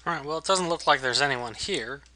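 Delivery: usual speaking voice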